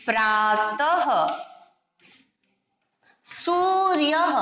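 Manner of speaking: प्रातः and सूर्यः drawn out slowly; careful slow dictation of प्रातः, सूर्यः; slow demonstration. Shown as a demonstration. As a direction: say each word slowly with a trailing h. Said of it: "इस तरह से ... अह की मात्रा लगा करके".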